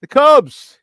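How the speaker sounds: loud, high Chicago sports-fan voice; Chicago accent. Acted. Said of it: "All right, my Chicago accent".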